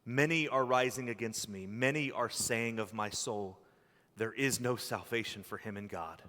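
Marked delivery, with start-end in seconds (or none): none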